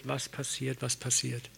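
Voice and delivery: wiederholt und immer leiser ausklingend; leiser werdend. Acted. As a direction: lass es verhallen. (immer leiser werdend)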